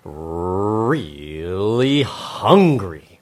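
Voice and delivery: slow, exaggerated storyteller; Robert Munch. Host opens a children's story, drawn-out storyteller voice. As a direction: hugely stretch the key word. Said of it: "get my Robert Munch voice going".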